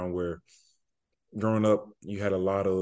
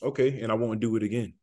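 ordinary talk, nothing marked

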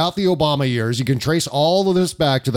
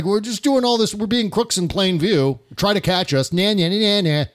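taunting; 6 semitones higher than usual. Retold or imitated. Taunting crooks voiced mockingly.

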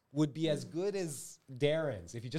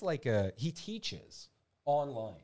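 ordinary delivery

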